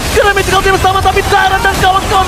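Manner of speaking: excited play-by-play. Real, not acted. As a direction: build hype through the fight. Caster calls a teamfight.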